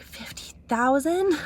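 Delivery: drawn-out, high-pitched disbelieving question; mock astonishment. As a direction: imitate a stunned echo, slow and high. Printer's astonished echo, retold.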